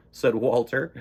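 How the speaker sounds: usual speaking voice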